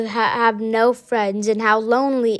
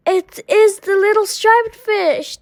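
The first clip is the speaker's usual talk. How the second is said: higher, anxious answer; worried; 8 semitones higher than usual. A narrator's reading. Rainbow Fish explaining the danger, worried. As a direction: tell them with fear for the friend.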